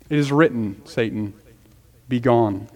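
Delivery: low, hushed dismissal of Satan; softly spoken, deep-pitched command; quiet rebuke. Acted. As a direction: quote the rebuke softly and low, without raising the voice. Quotes the Savior's firm rebuke.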